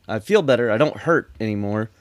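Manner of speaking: ordinary talk, nothing marked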